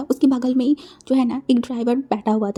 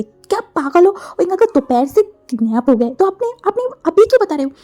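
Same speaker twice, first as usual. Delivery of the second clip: higher and louder, fast furious scolding; angry outburst; 7 semitones higher, 4 dB louder than usual. Acted. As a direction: yell at her for hiding it this long. Furious scolding over late news. (गुस्से में ऊँची और तेज़ आवाज़ में डाँटना)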